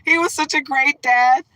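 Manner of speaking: high, loud hammed-up lament; exaggerated high, loud gushing; mock wailing. Hammed-up grief over Mufasa.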